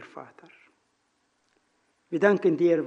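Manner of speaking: usual speaking voice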